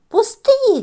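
put-on high, loud child's shout; shouted protest. A narrator's reading. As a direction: yell it, pulling away from mum. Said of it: "кричить Тім". (гучний високий дитячий крик «Пусти!»)

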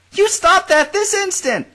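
high, loud, slow scolding cry; indignant cry. Acted. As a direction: cry out in flustered outrage. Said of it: "Marina cried".

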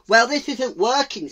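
loud, high owl voice; exasperated owl. Acted. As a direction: complain to the gathered animals.

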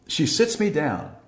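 ordinary talk, nothing marked

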